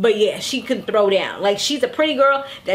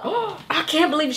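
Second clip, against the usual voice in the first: gasp, then soft, higher disbelief; mock shocked gasp; 4 semitones higher, 4 dB quieter than usual. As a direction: imitate someone gasping in shock. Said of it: "because you gasped".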